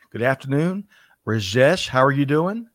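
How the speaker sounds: ordinary delivery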